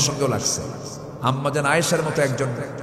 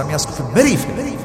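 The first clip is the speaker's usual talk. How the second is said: acted outrage with a loud high burst in the middle; hammed-up angry outburst; enraged father; 6 semitones higher, 4 dB louder than usual. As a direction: play a furious father threatening his daughter. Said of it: "এত খেপে গেছেন".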